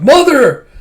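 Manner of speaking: loud, high scream of a name. Retold or imitated. Micah in the dream screaming for her mother, loud high scream. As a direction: scream it out in terror. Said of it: "She screams out".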